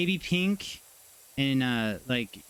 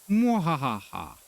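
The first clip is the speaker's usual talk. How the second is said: slow hammy evil cackle; villain laugh, drawn out; mad scientist laugh; 2 semitones higher, slower than usual. Pretend. Evil laugh after teasing chat.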